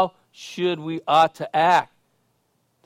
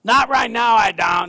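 loud holler dropping in pitch at the end; imitated homeless man; 4 semitones higher, 5 dB louder than usual. Homeless man refuses water from below, imitated hollering. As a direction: shout it back like a hollering street man. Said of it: "That's how he talks".

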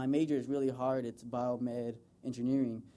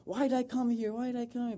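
mock frustrated yell; 9 semitones higher than usual. Son venting at mom about college, high mock wail. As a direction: throw up your hands, not really shouting. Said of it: "yelling at her".

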